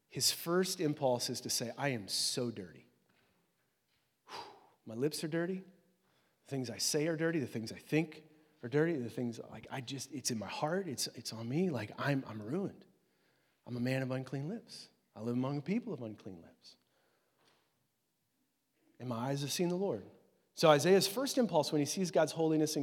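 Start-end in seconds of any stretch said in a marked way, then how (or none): 1.8-5.6 soft, subdued admission of shame; low, hushed dismay; dismayed confession. Acted. Isaiah's awed, dismayed confession voiced. 6.5-20.0 soft, low, halting confession; subdued, acted dismay. Isaiah's awed, dismayed confession voiced.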